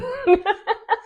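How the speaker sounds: sudden high-pitched giggle; a high squeal of laughter. Real, not acted. Laughing at the cast not eating.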